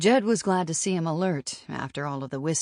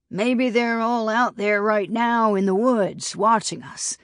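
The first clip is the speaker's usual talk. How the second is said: higher and louder, a spooky mocking taunt; cackling tease; 7 semitones higher, 6 dB louder than usual. A narrator's reading. Roscoe leaning toward Dobbs to scare him, mocking cackle. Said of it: "He cackled again".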